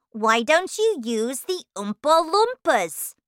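put-on high, loud boy's voice; loud, high-pitched challenge; questioning boy. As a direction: demand it like a pushy child. Mike questions Wonka, acted.

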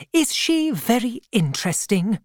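put-on a kindly, no-nonsense old aunt greeting an old acquaintance; elderly aunt. A narrator's reading. Aunt's voice, held.

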